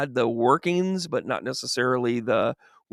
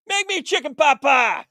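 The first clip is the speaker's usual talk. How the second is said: demanding Adam; 12 semitones higher than usual. Acted. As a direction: put on a high-pitched bossy voice. Adam demands food in bit.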